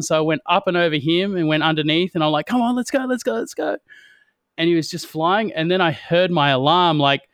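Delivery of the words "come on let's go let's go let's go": high, excited urging; eager urging. Retold or imitated. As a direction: pump him up to race. Urging flyer mid-race.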